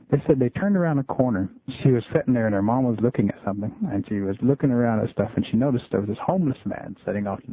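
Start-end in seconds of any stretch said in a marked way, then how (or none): none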